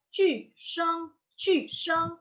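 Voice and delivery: "qu" high and dropping sharply, "sheng" high; falling fourth tone. Shown as a demonstration. Teacher naming the fourth tone, sharp fall. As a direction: throw "qu" down from the top. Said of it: "langsung dihentak, nada turun".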